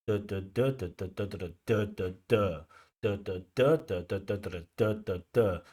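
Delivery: duh syllables tapping out the stress beats; stress rhythm beats. Shown as a demonstration. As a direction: tap out the sentence rhythm with duh, duh. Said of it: "So, you know".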